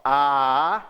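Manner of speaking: long, low, held "A"; sustained teaching "A"; open vowel demo. Shown as a demonstration. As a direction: let "A" flow freely from an open mouth. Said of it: "em vez de eu falar".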